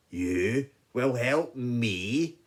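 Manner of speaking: loud, slow lion in disbelief; incredulous lion. Acted. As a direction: boom it slowly, scornfully. Lion scoffs.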